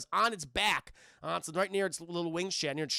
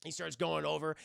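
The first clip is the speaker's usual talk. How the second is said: ordinary talk, nothing marked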